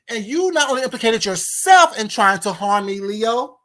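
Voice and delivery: ordinary talk, nothing marked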